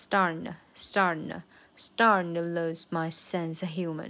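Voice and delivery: "starting to" run together, "to" a tiny schwa; to weakened to schwa. Shown as a demonstration. Teacher showing the weak form, "to" as a schwa after "starting". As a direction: stress "starting", reduce "to" to an uh. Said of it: "所以就变成schwa".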